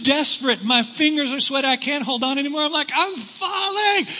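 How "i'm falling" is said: high, slow wail while slipping; drawn-out mock panic; losing the grip; panicked wail.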